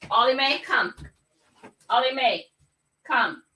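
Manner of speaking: calling the dog by name. Real, not acted. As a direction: call the dog over.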